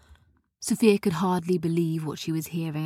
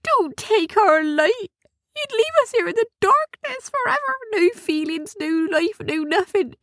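pleading. Acted. Gnomes pleading with Sophia to leave their light, very high. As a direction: beg as if losing everything. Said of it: "they pleaded".

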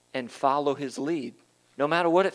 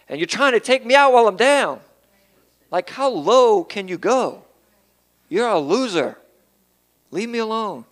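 higher, louder, indignant protest at a bully; confronting the devil; 4 semitones higher, 5 dB louder than usual. Acted. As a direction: push back hard at the one kicking you when you're down. Preacher confronts the devil directly.

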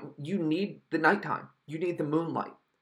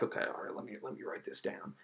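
whispered, much softer Michael taking notes; mock Michael whisper; 3 semitones lower, 12 dB quieter than usual. Reviewer mocks Michael writing down Tina's plans, whispered. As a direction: hush it like a killer jotting notes. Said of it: "and he was like".

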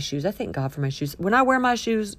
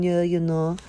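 usual speaking voice

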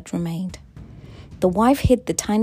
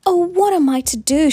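high, loud wail of dismay; raised voice, pleading for an answer; distressed cry; 9 semitones higher, 8 dB louder than usual. A narrator's reading. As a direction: cry out at a loss. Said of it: "she cried".

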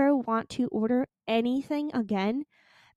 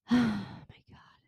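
a long tired sigh into oh my God; weary sigh; 4 dB quieter than usual. Real, not acted. Vlogger sighing at her camera, worn out. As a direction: breathe out the whole week. Sighs at end of rant.

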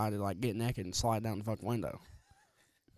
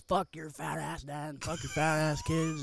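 higher, drawn-out imitation of an angry shout; slow, raised-pitch mock hollering; mock angry yell; 5 semitones higher, slower than usual. Neighbor cursing dad from the floor below, mock angry yell.

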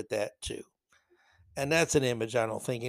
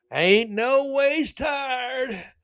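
much higher, very slow fake Southern preacher drawl; mock Southern drawl; 11 semitones higher, 4 dB louder, much slower than usual. Mocking Hillary Clinton's fake Southern accent, high and drawn out. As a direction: ham up a put-on Southern accent. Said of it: "Remember that Hillary Clinton?".

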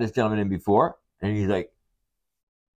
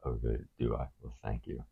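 very low, soft reply; bashful thanks from down in the chest; seductive deep voice; 10 semitones lower, 12 dB quieter than usual. Acted. Acting out deep-voiced man being flattered.

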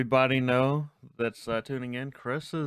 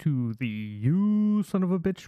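announcer; slower than usual. Acted. Podcast intro announcement.

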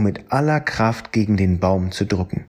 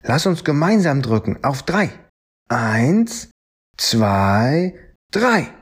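higher, rallying count with a high last number; counting to three; 5 semitones higher than usual. Acted. Fred counts the friends in to push the tree, rising on drei. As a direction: lead the push, jump up on drei.

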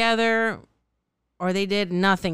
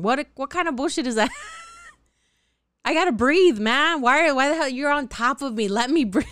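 exasperated rant; 6 semitones higher than usual. Real, not acted. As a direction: vent it like you're suffocating. Rants about clingy ex.

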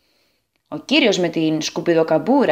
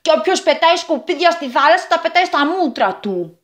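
higher, lightly voiced chanting boys; boys in unison; 7 semitones higher than usual. A narrator's reading. Three boys answer the girl in one voice, chanting. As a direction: chant it together like schoolboys. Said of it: "είπαν και τα τρία αγόρια με μία φωνή".

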